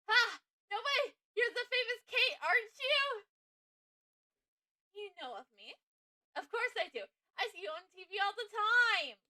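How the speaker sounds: loud, high-pitched fan gushing; starstruck excitement. Acted. Assistant recognizes a TV celebrity, starstruck. Assistant meets famous TV host.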